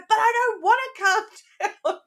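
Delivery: high mock child whine with a laugh; protesting little boy. Little son refusing to calm down, playfully imitated in high voice. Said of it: "when he was little".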